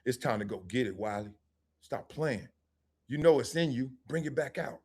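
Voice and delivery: low, hushed pep talk to oneself; mirror self-talk. Retold or imitated. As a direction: quietly psych yourself up, acted out. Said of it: "I look in the mirror, I say".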